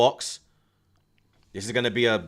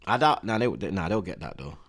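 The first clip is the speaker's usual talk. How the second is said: ordinary talk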